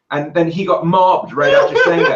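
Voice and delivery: ordinary talk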